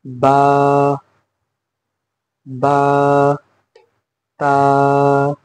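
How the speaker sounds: बा and ता each held long and even; drawn-out letters. Shown as a demonstration. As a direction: stretch बा, then ता, like a Quran teacher. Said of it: "अगला letter है".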